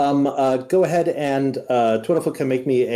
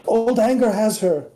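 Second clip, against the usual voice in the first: high, stressed anger repeated; agitated revelation; 10 semitones higher than usual. Acted. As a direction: press the words old anger.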